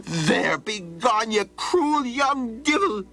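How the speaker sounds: put-on high, slow outraged old man; angry shout. Old man shouts at Donald to be gone, high and outraged. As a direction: spit out the last curse.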